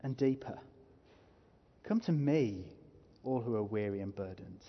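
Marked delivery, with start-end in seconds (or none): 1.8-4.6 "Come to me" with weight on "me", the rest subdued; stress on "me". Shown as a demonstration. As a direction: put the emphasis on "me". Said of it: "put emphasis on different words".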